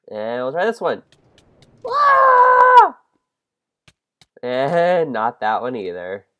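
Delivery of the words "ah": very high, loud shriek; loud scream. Real, not acted. Riding the minecart into a drop, loud high shriek. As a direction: shriek as the cart drops.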